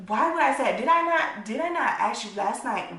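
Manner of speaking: imitating a friend's heated denial; retold outburst. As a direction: throw her words back at her, heated. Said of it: "She goes".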